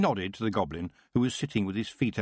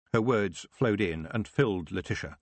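plain reading voice

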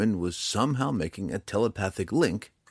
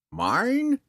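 very high, louder incredulous question; 5 dB louder than usual. A narrator's reading. Old man questioning the drow, incredulous. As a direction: shoot up high in disbelief. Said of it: "the old man asked incredulously".

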